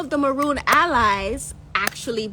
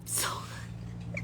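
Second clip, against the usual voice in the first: whispered; 15 dB quieter than usual. Real, not acted. Whispered tag after praise.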